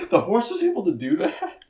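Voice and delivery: high incredulous question; amused disbelief. Retold or imitated. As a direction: squeak out the disbelief. Amazed question.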